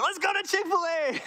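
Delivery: put-on eager kid begging for his favourite spot; excited. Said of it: "I'd be so excited".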